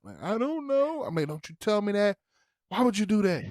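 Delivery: put-on very high girl's voice echoing the yell; Hazel talking back. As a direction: squeak it back like the daughter. Hazel's reply voiced in story.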